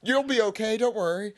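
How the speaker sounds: high, pleading, overacted concern; worried henchman. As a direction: cry out for the fallen boss. Voicing Kiros' concern for Doflamingo.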